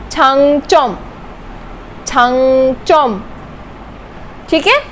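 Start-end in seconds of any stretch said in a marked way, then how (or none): 0.1-3.2 छांगचोम with clear ch, slowing; slow pronunciation demo. Shown as a demonstration. Teacher modelling an exception word. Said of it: "अंग का exception case".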